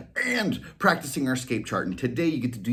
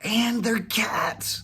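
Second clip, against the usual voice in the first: slow, pleased whisper; whispered cat voice; 12 semitones higher, much slower than usual. Acted. Whiskers whispering happily to Molly, slow. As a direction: savor the ending.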